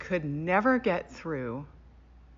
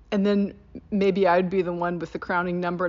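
usual speaking voice

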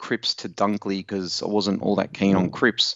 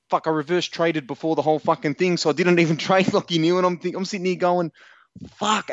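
losing it; 8 semitones higher than usual. Retold or imitated. Teller re-enacts realising his trade blunder mid-game, high-pitched and losing it. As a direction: replay your own dismayed outburst, pitched right up. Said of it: "I've just lost my shit".

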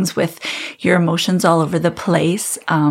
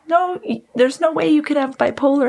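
high-pitched imitation of a doubting doctor; dismissive doctor; 9 semitones higher than usual. Doctor rejecting her bipolar idea, high mock dismissal. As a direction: wave the patient off in a raised mock voice.

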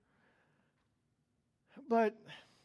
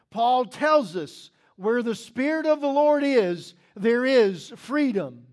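preaching; 7 dB louder than usual. Acted. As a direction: proclaim it to the congregation. Preaching the theme verse.

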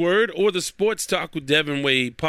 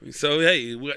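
usual speaking voice

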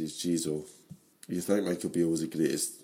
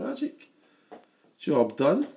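delighted inner thought; 7 semitones higher, slower than usual. Retold or imitated.

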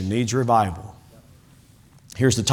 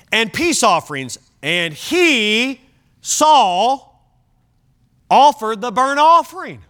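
slow, higher pulpit declamation with weighty pauses; emphatic scripture reading; 7 semitones higher, 4 dB louder, slower than usual. Acted. Preacher reading Saul's wrongful offering to the church, dramatic declamation. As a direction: proclaim the verse phrase by phrase.

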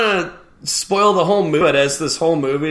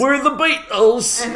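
high, slow mock Liverpool accent; 8 semitones higher, slower than usual. Podcaster posing as a Beatle, mock Liverpool accent. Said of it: "is [name] in st".

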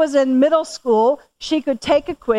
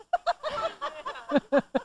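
high laugh; 13 semitones higher, 8 dB quieter than usual. Real, not acted. Speaker laughs at herself.

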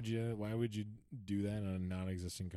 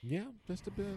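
high sarcastic understatement; 13 semitones higher than usual. Acted. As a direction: say it dry and mocking.

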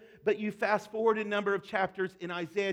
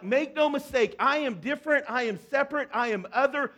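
higher, louder commanding declaration; voice of God; 5 semitones higher, 5 dB louder than usual. Retold or imitated. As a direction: declare it as God, firm and bold. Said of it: "God's like".

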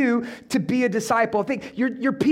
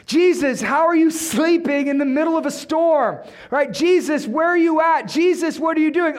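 frantic shout; 6 semitones higher, 4 dB louder than usual. Acted. Disciples shouting at sleeping Jesus.

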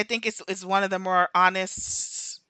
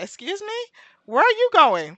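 pitched up, a mock-shocked excuse me; incredulous; 12 semitones higher, 6 dB louder than usual. Viewer scolding a TV character in mock shock, higher. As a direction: act out disbelief at the character leaving. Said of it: "I said".

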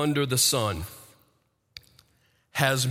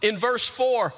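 higher, ringing sermon voice; full-force preaching; 7 semitones higher than usual. Acted. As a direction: preach it out with conviction.

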